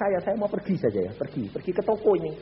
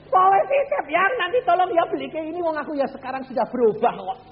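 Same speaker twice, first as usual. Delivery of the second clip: cheerful agreeing; 13 semitones higher, 6 dB louder than usual. Retold or imitated. Preacher voicing new gentle self.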